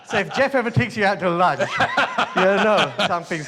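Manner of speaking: high, loud, through real laughter; laughing joke. Real, not acted. Guest joking with host about being fired over lunch, laughing. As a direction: crack up while telling it, pitch riding up.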